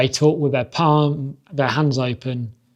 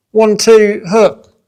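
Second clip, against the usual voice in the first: much higher, louder barked combo calls; corner shouting calls; 7 semitones higher, 10 dB louder, much slower than usual. Retold or imitated. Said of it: "all you hear is".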